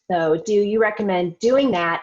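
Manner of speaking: usual speaking voice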